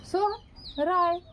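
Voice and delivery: Sohrai drawn out slowly, syllable by syllable; dictating Sohrai slowly to the class; slow dictation. Shown as a demonstration. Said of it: "आराम आराम से सोहराय बोल रहे थे".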